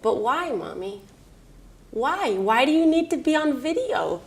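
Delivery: a hint of a small boy asking Mommy why; lightly voiced young son. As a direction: lightly imitate a puzzled little kid. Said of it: "So he said".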